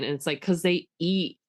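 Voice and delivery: ordinary talk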